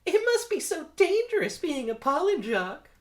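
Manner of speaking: very high, loud falsetto admirer; put-on girlish fangirl voice; lady bee. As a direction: coo loudly at a hero in falsetto. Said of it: "Lady one".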